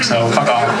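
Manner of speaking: ordinary talk, nothing marked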